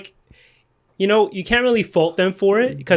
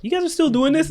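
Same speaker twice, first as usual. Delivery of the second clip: mock disbelief, pitched way up; incredulous dad; 11 semitones higher than usual. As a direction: imitate a dad who can't believe it, high voice. Acting out dad's disbelief at podcast.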